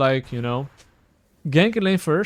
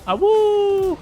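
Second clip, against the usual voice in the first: howling like a wolf pup; 4 dB louder than usual. Pretend. Playful wolf howl while gaming.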